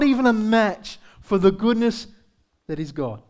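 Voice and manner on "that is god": ordinary delivery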